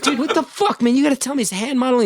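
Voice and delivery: high, indignant yelling at the manager; outraged. Retold or imitated. Angry call to his manager, high and indignant.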